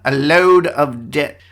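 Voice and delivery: slow, put-on Australian accent; drawn-out pretend Aussie accent; mock Australian accent. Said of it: "describe it in Australia".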